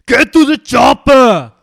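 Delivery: slow, full-volume Schwarzenegger yell; Arnie shout. Shown as a demonstration. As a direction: bellow the order like Arnie. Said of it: "You do it then".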